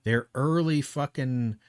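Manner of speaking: angry. Real, not acted. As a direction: hammer each word out in anger. Ranting rebuttal to debater.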